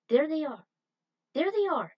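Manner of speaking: high, loud call of discovery; excited spotting. Acted. Scout calls out to the team on spotting the enemy, excited. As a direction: call it out as you spot them.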